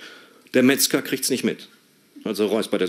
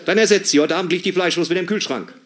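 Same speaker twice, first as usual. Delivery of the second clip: mother's order; 8 semitones higher than usual. Retold or imitated. Mother giving orders on phone.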